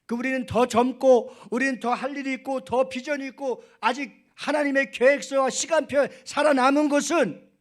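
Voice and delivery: high, very loud sermon crescendo; impassioned preaching. Real, not acted. Pastor marvelling at an elder's words, fired-up preaching. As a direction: preach it at full force, pitch held high.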